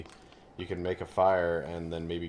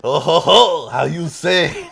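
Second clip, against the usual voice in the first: loud, high mock-French chortle; jolly over-the-top fake French, high and loud; horrible French accent; 8 semitones higher, 12 dB louder, slower than usual. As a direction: imitate a hammy bad French accent. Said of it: "horrible French accent".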